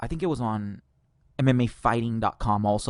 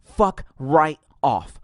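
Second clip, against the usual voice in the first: each word slow and heavy; drawn-out final dismissal; slow emphatic; 5 dB louder, much slower than usual. Real, not acted. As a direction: lay down every word. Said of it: "slow emphatic dismissal".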